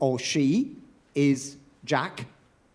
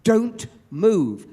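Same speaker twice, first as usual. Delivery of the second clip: director's order; 6 semitones higher, 6 dB louder than usual. Retold or imitated. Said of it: "saying to Peter and me".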